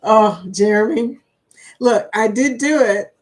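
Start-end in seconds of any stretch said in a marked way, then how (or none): none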